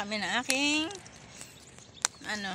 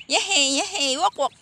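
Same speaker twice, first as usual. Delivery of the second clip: excited cheering; 4 semitones higher, 8 dB louder than usual. Real, not acted. Cheering the toddler on.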